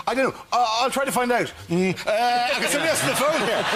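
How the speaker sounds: flustered president. Retold or imitated. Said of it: "he's going".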